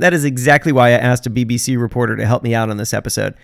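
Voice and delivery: usual speaking voice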